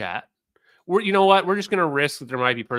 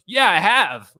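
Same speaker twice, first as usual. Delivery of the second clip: loud, higher, gleeful admission; Jack; 5 semitones higher, 9 dB louder, slower than usual. Retold or imitated. Streamer imitating Jack proudly owning his CIA connection, relishing it. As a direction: imitate someone relishing the attention. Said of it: "he loves it. He loves the attention".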